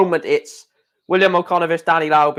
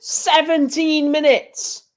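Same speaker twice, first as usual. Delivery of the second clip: much higher, slow, exasperated "Seventeen minutes!"; disbelieving; 8 semitones higher, slower than usual. Real, not acted. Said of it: "It's ridiculous".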